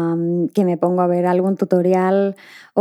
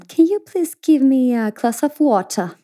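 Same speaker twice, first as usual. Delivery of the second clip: put-on higher British accent, a girl asking politely; little English girl's polite British ask; British child accent; 4 semitones higher than usual. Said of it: "ella me decía". (acento británico de niña)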